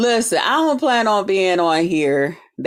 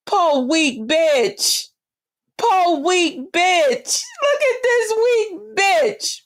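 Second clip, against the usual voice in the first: mock pity; 8 semitones higher, much slower than usual. Mocking pity for a rival.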